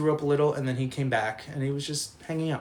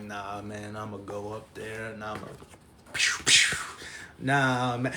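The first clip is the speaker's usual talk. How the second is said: put-on drunk Drew; 4 semitones lower than usual. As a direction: mumble the threat like a drunk tough guy. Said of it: "He's like".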